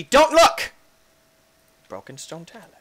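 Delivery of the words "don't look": high, loud mock order; mock command. Player ordering viewers not to peek, mock command. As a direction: shout a playful warning.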